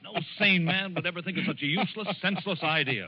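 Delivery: rising fury at a laughing madman; furious outburst. Acted. Editor shouting at the mad professor to stop laughing, furious.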